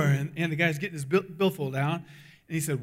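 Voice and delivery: ordinary talk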